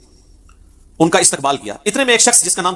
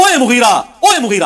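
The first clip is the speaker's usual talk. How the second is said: higher, drawn-out indignant shout; angry call; 6 semitones higher, 4 dB louder than usual. Retold or imitated. Companion angrily calling out Mughira, raised and drawn out. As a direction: call him out angrily, stretching the name. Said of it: "غصے میں کہتے ہیں".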